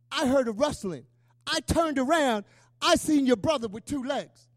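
loud, emphatic witness account; retold testimony. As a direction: proclaim the sighting, punching each phrase. Retold joyful sighting testimony.

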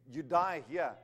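ordinary delivery

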